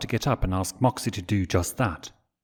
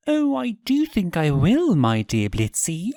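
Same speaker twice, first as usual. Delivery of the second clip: cooing; 9 semitones higher, 3 dB louder than usual. Acted. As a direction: coo it lovingly. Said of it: "Stolas cooed".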